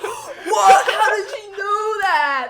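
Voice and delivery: high, very loud mock amazement; hammed-up astonished child outburst; imitated kids freaking out. Babysitter imitating kids amazed by her math, freaking out. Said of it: "they freak out".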